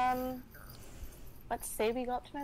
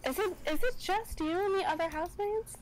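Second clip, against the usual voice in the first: put-on old housekeeper voice; 6 semitones higher than usual.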